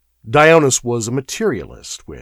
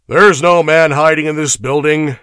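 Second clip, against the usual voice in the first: loud, raised-pitch complaint; growled report; 7 semitones higher, 9 dB louder than usual. A narrator's reading. Guardsmen back from their search, growling. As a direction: report it gruffly and forcefully. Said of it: "they growled".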